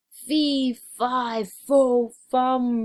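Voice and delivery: loud, high roared fee fi fo fum; roaring giant. Acted. The giant chases Jack, roaring loud and high. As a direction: bellow each word as the angry giant. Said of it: "roared the giant".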